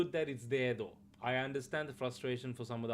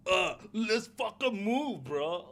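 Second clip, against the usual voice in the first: much higher, slow mock Australian accent; mocking Aussie line; 7 semitones higher, 4 dB louder, slower than usual. Streamer parodies the Witcher actor in a mock Australian accent. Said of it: "Geralt would never be like".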